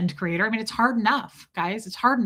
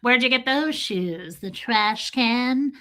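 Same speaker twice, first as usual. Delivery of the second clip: slow, drawn-out witch cackle voice; Wicked Witch voice; slower than usual. Retold or imitated. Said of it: "She sounded like the Wicked Witch".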